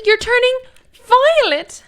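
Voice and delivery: high, stretched-out squeak; high squeaky quote. Acted. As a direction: squeal it slowly like the film. Quoting Willy Wonka line.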